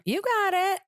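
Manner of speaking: very high, held-out mock cheer; imitating the instructor. Imitating the instructor from class, high and drawn out. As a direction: sing it out high, imitating him. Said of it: "he kept doing, like".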